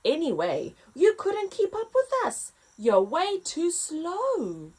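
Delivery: noisy ostrich. Acted. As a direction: taunt like a proud bird. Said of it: "squawked a noisy ostrich".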